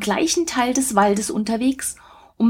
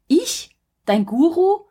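incredulous "Ich?" jumping high; a disbelieving question, voice shooting up; astonished; 8 semitones higher than usual. A narrator's reading. Teacher told he is the boy's guru, astonished.

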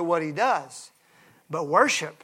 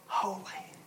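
whisper; 14 dB quieter than usual. Shown as a demonstration. Preacher whispers the response.

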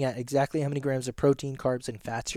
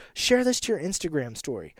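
ordinary talk

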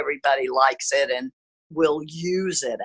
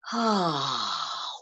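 audible exhale; 9 dB quieter than usual. Shown as a demonstration. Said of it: "take three deep breaths".

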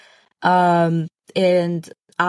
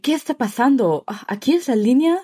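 light mock Castilian Spanish accent with a lisp, a bit higher; put-on Spanish accent; 6 semitones higher than usual. Pretending to be from Spain to fit in, mock Spanish accent. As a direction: play-act being from Spain in line. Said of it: "with the lisp".

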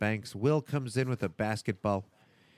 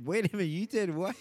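surprised; 8 semitones higher than usual. Retold or imitated. Neighbour confronts car waxer.

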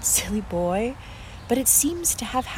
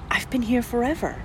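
usual speaking voice

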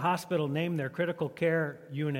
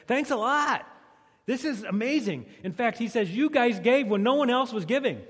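higher, louder heartfelt thanks; sincere thanks; 7 semitones higher, 5 dB louder than usual. Retold or imitated. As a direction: gush real gratitude. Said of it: "a sincere, non-sarcastic thank you".

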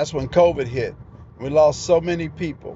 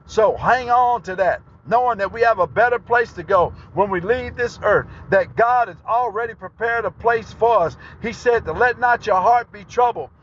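raised, high-pitched preaching voice, driving each phrase home; preaching at full force; 7 semitones higher than usual. Acted. Preacher rousing his brothers and sisters, raised preaching voice. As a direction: preach it up to the congregation, lifted and urgent.

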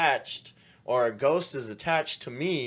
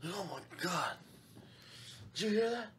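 mock scared; 8 semitones higher, 8 dB quieter, faster than usual. Mock ghost hunter hearing noise.

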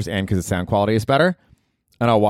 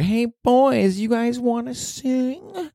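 high, drawn-out call to the crowd; over-speak it like a pop star onstage; much slower than usual. Retold or imitated. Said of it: "over speaking like that".

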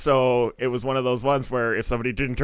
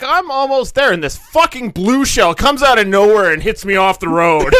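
loud, high-pitched comic outrage; mock road rage; 8 semitones higher, 8 dB louder than usual. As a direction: rant it like a furious gamer. Acting out comic road rage story.

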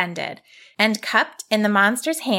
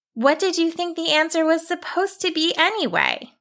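put-on young girl voice; 9 semitones higher than usual. Girl asks, high.

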